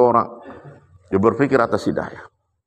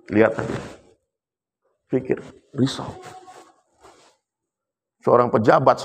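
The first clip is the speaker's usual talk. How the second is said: normal talking voice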